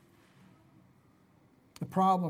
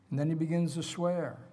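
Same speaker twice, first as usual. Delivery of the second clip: normal talking voice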